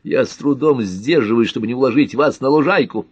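angry, forceful warning; barked threat. A narrator's reading. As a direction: threaten hotly with the voice lifted. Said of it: "рявкнул Картер".

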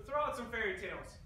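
ordinary talk, nothing marked

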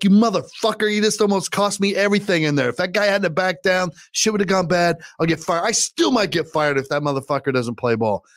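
high-pitched, worked-up telling-off, acted out; retold angry scolding. Scolds cousin after retold close call.

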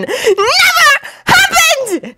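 high, drawn-out desperate wail; desperate high-pitched denial; desperate cry. A narrator's reading. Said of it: "cried out, sounding almost desperate".

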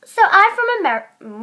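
American attempt that comes out English; botched, English-sounding American accent; failed American accent. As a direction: try American but stay very English. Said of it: "wow, that sounds so English".

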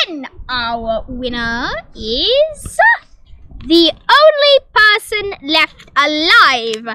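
slow, loud announcer build; hammed-up slow game-show reveal; dramatic slow announcement. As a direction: drag out the reveal like a host.

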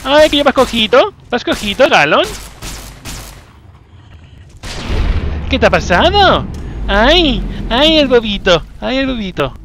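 high, loud cooing mockery; mock baby talk. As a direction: coo at the limping monster like a baby. Baby-talk mocking the limping monster. (burla aniñada, aguda y fuerte)

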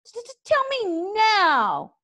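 high, slow, stuttering dragon voice; stammering little dragon. A narrator's reading. Small dragon stammering to Hiccup.

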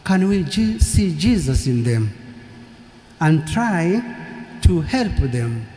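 usual speaking voice

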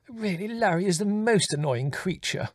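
high-pitched exasperated mother; angry exclamation. A narrator's reading. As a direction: exclaim crossly, voice pitched well up. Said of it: "Mother exclaimed angrily".

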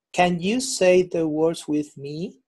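slow, with a rise on the final "me"; question intonation. Shown as a demonstration. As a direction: ask it slowly, lifting "me" at the end. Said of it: "use the intonation at the end".

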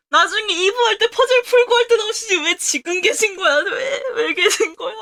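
laughing while whining; whimpering through laughter; laughing whine. Real, not acted. Embarrassed laughing crying.

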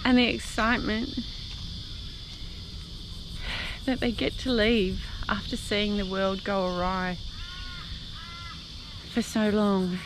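speaking through grateful tears; happy tears. Real, not acted. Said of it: "yes, I am crying".

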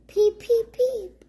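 high, loud little chick peeps. Acted. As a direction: chirp like a tiny chick. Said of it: "fluffy chicks say".

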